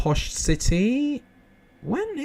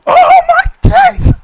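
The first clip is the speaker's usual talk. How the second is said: very high, loud, drawn-out scream; screamed celebration; 15 dB louder, slower than usual. Real, not acted. Streamer celebrating a last-minute goal, screaming. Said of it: "reaching my [name] notes with that celebration".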